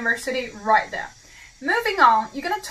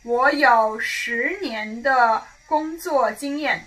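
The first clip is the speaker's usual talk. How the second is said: slow model sentence; slower than usual. Shown as a demonstration. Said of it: "So say".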